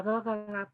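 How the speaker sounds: soft guttural ga ga from the throat; imitating a cooing baby; throaty baby ga. Shown as a demonstration. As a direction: coo it from the back of the throat, quietly. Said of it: "como de aquí de la garganta".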